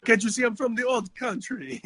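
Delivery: put-on high, laughing Italian-American accent. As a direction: ham up an Italian-American tough guy accent. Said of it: "My Italian accent".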